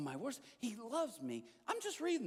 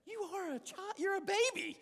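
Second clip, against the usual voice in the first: mock friend scolding; 12 semitones higher than usual. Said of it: "I told a friend of mine this".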